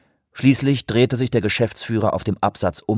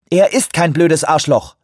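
firm, raised and louder rebuke; 8 semitones higher, 6 dB louder than usual. A narrator's reading. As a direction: set him straight, no room for argument. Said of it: "sagte sie sehr bestimmt".

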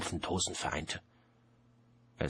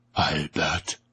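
loud summons to a servant; calling out; 7 dB louder than usual. A narrator's reading. Death summons his servant Albert, loud and commanding.

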